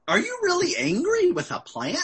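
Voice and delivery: high-pitched, disbelieving challenge; incredulous question. Retold or imitated. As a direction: question it as if absurd. God questions Jonah's anger over plant.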